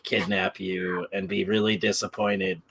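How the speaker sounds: ordinary talk, nothing marked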